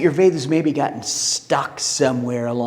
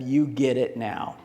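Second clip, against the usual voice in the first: softer, slower knowing answer; gentle smile; 6 semitones lower, 6 dB quieter, slower than usual. Acted. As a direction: let it land quietly, kindly. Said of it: "Saint Peter smiled at him".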